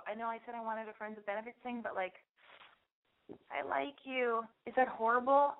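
hushed nervous confession. Pretend. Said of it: "you go".

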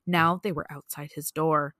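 ordinary talk

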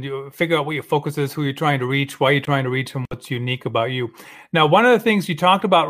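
usual speaking voice

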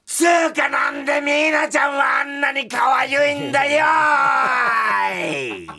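high, loud frantic ranting; frantic shout. Acted. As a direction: yell it like a lovesick tantrum. Reads ranting letter in outburst. (半泣きで叫ぶ)

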